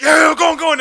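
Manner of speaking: loud jeering chant of a crowd; rowdy kids circling a scuffle, loud jeering chant; crowd egging on. Retold or imitated. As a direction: bellow it like a schoolyard mob. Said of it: "it's like".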